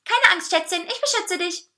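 high, loud cake voice with a touch of growl; lightly growled, high-pitched bravado; growling protector. A narrator's reading. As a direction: play the little bodyguard. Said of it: "knurrte der Kuchen".

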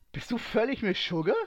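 high, loud, aghast question; stunned hissed outburst. A narrator's reading. Sky aghast at Ray's blunt confession, incredulous hiss. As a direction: snap at them in sheer disbelief. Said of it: "fauchte er zur Abwechslung doch mal fassungslos".

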